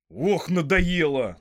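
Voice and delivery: loud, drawn-out exasperated cry; fed-up outburst. A narrator's reading. Sam bangs the table, loudly exasperated. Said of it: "стукнул кулаком по столу Сэм".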